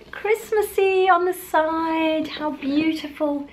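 delighted cooing. Real, not acted. Admiring a gift box from her friends, slow delighted coo. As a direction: coo over something beautiful.

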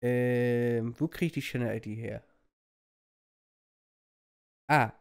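usual speaking voice

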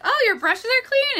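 high-pitched mock amazement at a friend's tidiness; imitating friend's delight. Friend noticing her clean brushes the other day, imitated delight. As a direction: imitate a pleasantly surprised friend, pitch jumping up. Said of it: "She was like".

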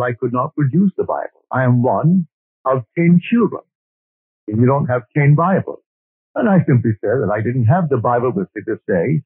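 ordinary talk

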